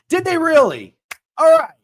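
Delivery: sudden high, loud delight; gleeful surprise. Real, not acted. Streamer delighted that Arizona State lost big. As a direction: jump at the good news. Reacting to ASU losing.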